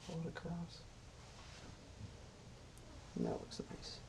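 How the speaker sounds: hushed, breathy whisper of approval; gentle painting instructor. Acted. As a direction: murmur it softly like a calm TV painter. Soft aside while painting.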